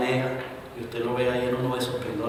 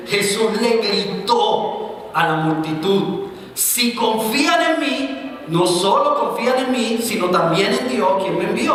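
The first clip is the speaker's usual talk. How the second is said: slow, high, loud proclamation; Jesus crying out; 8 semitones higher, 5 dB louder, slower than usual. Acted. Preacher voicing Jesus crying out to crowd. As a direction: call it out slowly to the crowd.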